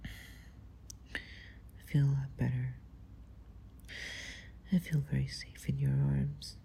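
ordinary talk

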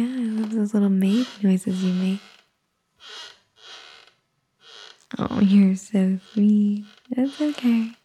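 soft, hushed, lulling to sleep; soothing a child. Acted. Settling the baby to sleep.

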